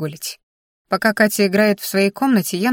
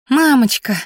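put-on excited little girl; 5 semitones higher, 5 dB louder than usual. Daughter greets mother joyfully.

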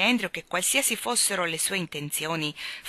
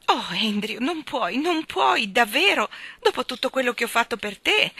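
high, loud, wounded pleading; almost in tears; 6 semitones higher, 6 dB louder than usual. A narrator's reading. Miss Lark begging her dog not to leave, near tears. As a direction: plead as if about to cry. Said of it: "Miss Lark era quasi in lacrime".

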